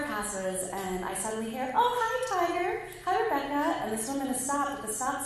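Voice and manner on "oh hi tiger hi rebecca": high singsong greeting to pets; friendly neighbor greeting. Retold or imitated. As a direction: imitate a woman cooing hello to animals. Passing woman greets the goats.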